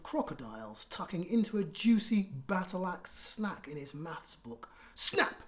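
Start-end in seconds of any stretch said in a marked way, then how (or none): none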